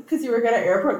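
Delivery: usual speaking voice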